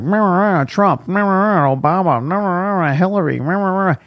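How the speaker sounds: a hint of droning political chatter; mock babble. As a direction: parody a nonstop political talker.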